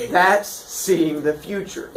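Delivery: usual speaking voice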